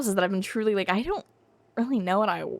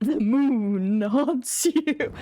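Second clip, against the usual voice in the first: drawn-out, slow eerie pronouncement; hammed-up doom, very slow; mock ominous; 3 semitones higher, much slower than usual. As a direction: stretch it out like a spooky omen. Mock-ominous game line.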